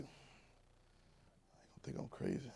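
slow whisper to yourself; whispered thought. Retold or imitated. Alone among family, slow whispered doubt. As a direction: breathe it as a private doubt. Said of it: "It's like".

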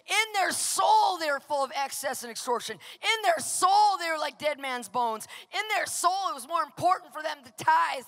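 loud, hammering preacher cadence on soul; fiery preaching. Acted. Pastor exhorting the congregation, loud fiery preaching. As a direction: preach it at full force to the congregation. Preacher drives home point, full force.

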